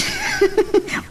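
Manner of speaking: high giggling laugh at his friend; teasing laugh. Acted. Friend teasing Pards about the rich girl, laughing. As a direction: laugh to tease your buddy.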